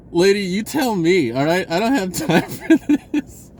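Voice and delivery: high, loud, laughing mock impatience; retold exasperation. Telling the lady there is no time, mock exasperated. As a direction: act out snapping at the lady, half-laughing. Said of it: "I was so frustrated".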